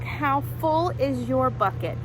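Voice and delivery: ordinary delivery, nothing marked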